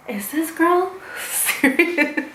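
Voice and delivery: high, slow disbelief breaking into laughter; incredulous laugh. Retold or imitated. Storyteller reacting to friend's trash talk, laughing in disbelief. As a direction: draw it out and laugh through it.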